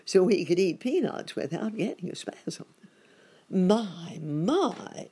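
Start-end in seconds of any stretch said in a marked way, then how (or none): 3.5-5.1 higher-pitched astonished exclamation; voiced-up wonder; exclaiming rabbit character. A narrator's reading. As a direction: exclaim it as a startled storybook rabbit. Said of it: "exclaimed Uncle Wiggily".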